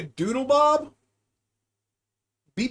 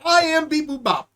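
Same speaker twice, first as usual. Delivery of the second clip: higher robot self-intro; light robot voice; 5 semitones higher than usual. Pretend. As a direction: proclaim like a toy robot. Robot character again.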